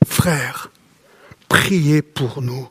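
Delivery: low, very slow and soft, the plea repeated; slow solemn reading. Acted. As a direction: draw out each word of the plea. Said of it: "il crie son besoin".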